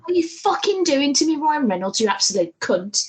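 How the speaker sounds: real gushing fan excitement, pitch jumping high; excited. Real, not acted. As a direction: rant with overexcited mock outrage at the wait. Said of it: "I'm so, I'm excited. I'm sorry".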